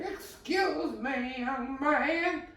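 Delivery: put-on very high, slow old lady voice; high mock granny calling out. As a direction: squeak it out like a fussy old lady. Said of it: "and she was like".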